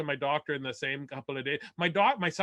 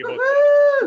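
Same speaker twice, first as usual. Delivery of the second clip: much higher, louder proud exclamation; proud outburst; 12 dB louder, slower than usual. Real, not acted. Comic tells the crowd his weight loss, loud high proud cry. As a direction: announce it with a crack of joy.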